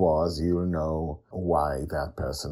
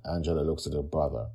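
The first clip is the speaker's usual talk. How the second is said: usual speaking voice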